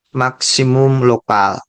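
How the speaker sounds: normal talking voice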